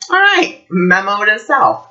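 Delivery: high, sing-out "all right"; acted-out mental note; wry resolve. Retells own reaction to the lesson.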